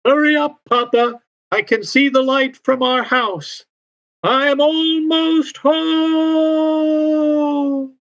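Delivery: put-on very high young voice calling to Papa; high-pitched, eager child's holler. A narrator's reading. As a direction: call from up ahead, stretching the last words long. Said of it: "Lauren's voice can be heard".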